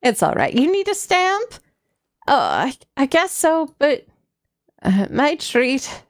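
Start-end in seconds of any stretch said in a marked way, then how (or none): 0.5-1.5 grandma voice. Acted. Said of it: "I was doing grandma voice".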